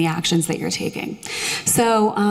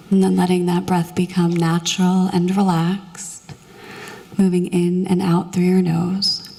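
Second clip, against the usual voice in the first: soothing meditation voice; 2 semitones lower than usual. Acted. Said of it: "my very soothing meditation voice".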